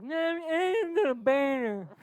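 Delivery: put-on high, loud, slow drunken boast; imitating her drunk self. She mimics her drunk self announcing to the front desk, loud and high. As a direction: ham up a tipsy proud claim. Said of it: "That's how I think it sounds in my head, but it was probably like".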